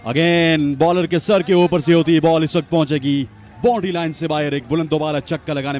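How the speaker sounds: loud, high call of the six; excited cricket commentary. Real, not acted. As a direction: shout it over the crowd.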